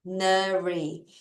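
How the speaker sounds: very slow, drawn out; teacher modelling ordinary slowly; slow pronunciation. Shown as a demonstration. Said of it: "발음해볼게요".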